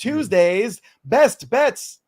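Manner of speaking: high, drawn-out segment announcement; announcer. Acted. Host introduces the betting segment, slow announcer call. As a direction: draw it out like a show announcer.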